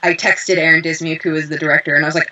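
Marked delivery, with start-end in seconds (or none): none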